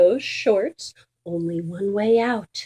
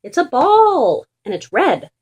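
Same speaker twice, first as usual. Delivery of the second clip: exasperated correcting; 6 dB louder than usual. Acted. Dog correcting the confused pigeon, loud and exasperated. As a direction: set the silly pigeon straight.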